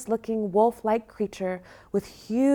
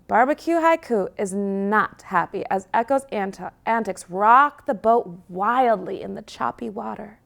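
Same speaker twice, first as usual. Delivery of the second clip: ordinary delivery, nothing marked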